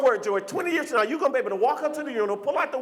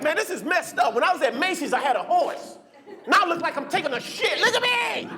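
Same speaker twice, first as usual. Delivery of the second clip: higher, fed-up complaining voice that climbs to a yell; upset polo man; 6 semitones higher than usual. Retold or imitated. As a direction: whine it higher and higher till you shout. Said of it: "He's like".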